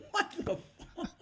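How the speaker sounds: high, laughing "what the"; stunned disbelief. Retold or imitated. Bandleader reacting to the unknown guest singer, high and laughing. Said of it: "I'm going like".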